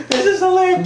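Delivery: very high squealed mock hilarity; imitated laughing. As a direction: mimic coworkers cracking up mid-puke. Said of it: "laughing in the midst of it".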